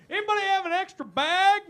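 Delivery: mock yokel. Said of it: "where they're just like".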